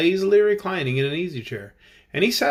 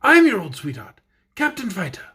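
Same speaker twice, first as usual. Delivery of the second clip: Tin Soldier voice; 4 semitones higher, 4 dB louder than usual. A narrator's reading. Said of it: "said the Tin Soldier".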